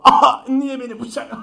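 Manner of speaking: high, loud mock panic; panicked shriek. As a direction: shriek at the knife. Voicing scared friend with knife.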